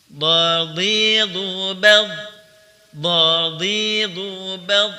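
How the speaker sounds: slow, ringing echo on the final bad; drawn-out qalqalah drill. Shown as a demonstration. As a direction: chant the syllables slowly and let the bad bounce. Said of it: "Ambil napas dulu".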